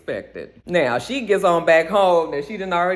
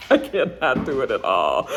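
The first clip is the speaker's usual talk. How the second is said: laughing at their own failed accent; 3 semitones lower than usual. Real, not acted. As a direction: speak through real giggles.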